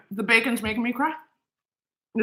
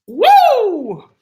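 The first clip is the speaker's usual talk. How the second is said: high, loud whoop of delight; excited whoop; 10 semitones higher, 7 dB louder than usual. Real, not acted. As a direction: let out a joyful woo. Reacting to the delicious sandwich.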